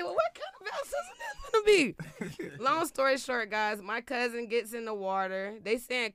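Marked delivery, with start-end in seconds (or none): 0.0-2.6 very high, thin incredulous voice; hammed-up high-pitched pastor; high mock question. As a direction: squeak it out in disbelief.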